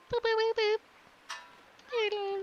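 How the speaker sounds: very high little boops; playful high-pitched noodling noises; high booping. Pretend. Player amusing themselves mid-game, high booping.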